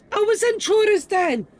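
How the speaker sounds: loud, high sudden realization; excited exclamation. Real, not acted. Realizes his mistake, exclaims.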